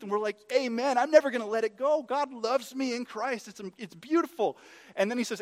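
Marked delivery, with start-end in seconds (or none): 0.5-4.6 high, loud, jubilant agreement; fervent amen. Acted. Congregation responding gladly to the gospel truth, fervent. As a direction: affirm it joyfully at a raised pitch. Acts out believers' joyful amen.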